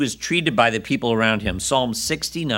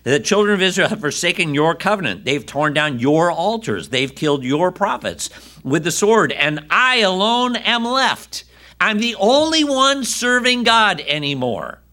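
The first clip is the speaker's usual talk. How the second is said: Elijah's lament; 7 semitones higher, 3 dB louder than usual. Retold or imitated. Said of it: "He says".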